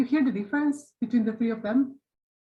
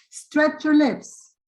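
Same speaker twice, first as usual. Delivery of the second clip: slow, drawn-out instruction to the class; slow coaching; 6 semitones higher, 4 dB louder, much slower than usual. Shown as a demonstration. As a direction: stretch it out as you coach. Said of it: "as I am telling you".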